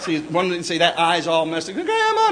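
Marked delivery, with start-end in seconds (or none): 1.7-2.3 put-on high wailing child's cry. Granddad imitating crying granddaughter calling Grandma, high wail. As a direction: wail it like an upset little girl.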